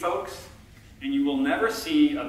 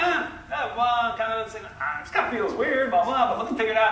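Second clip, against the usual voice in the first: higher-pitched, flustered stalling; acted awkward floundering; 7 semitones higher than usual. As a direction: stumble about as if caught unprepared. Acts out floundering at the mic.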